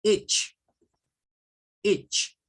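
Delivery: "Itch" with short English I and ch. Shown as a demonstration. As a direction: say "Itch" clipped, short i, ch ending. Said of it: "Esto es con un I". ("Itch" con I corta del inglés)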